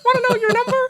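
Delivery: loud high street urchin call; Dickensian urchin. Pretend. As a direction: hawk it like a cheeky urchin. Counting boy hawking numbers.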